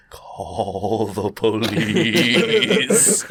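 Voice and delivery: slow, drawn-out cartoon walrus voice. Retelling a cartoon to friends, slow walrus voice. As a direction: drag it out slow like a dopey walrus.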